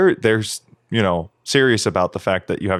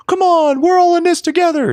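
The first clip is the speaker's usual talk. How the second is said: very high, loud cheesy unity call; rally the crowd with a hollow line; mock frontman rallying; 6 dB louder than usual. Podcaster mocks a singer's fake unity speech, loud high rally. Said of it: "rather than just like".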